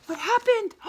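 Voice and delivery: surprised gasp. Acted. Story turn, sudden surprise.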